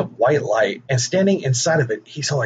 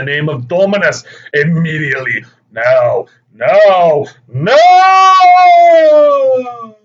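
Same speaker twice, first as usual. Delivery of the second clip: commanding angel; 6 dB louder than usual. Retold or imitated. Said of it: "hear a voice break into the prayer saying".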